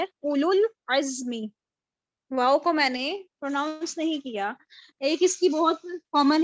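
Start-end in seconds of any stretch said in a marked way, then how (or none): 0.0-1.3 slow اولل عزم, the waw not pronounced; slow Quran recitation. Shown as a demonstration. Teacher reciting the correct اولل عزم slowly for students. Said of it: "میں نے کیا پڑھنا ہے؟".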